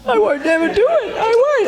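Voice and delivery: high, wailing cry; mock howling. Crying in the shower over the lost job, hammed-up wailing. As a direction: wail like all hope is lost.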